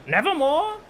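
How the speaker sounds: high, eerie croaking raven cry. Acted. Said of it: "Quoth the raven".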